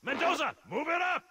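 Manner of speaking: loud, high call across the field. Acted. Sergeant shouting Mendoza forward, loud call.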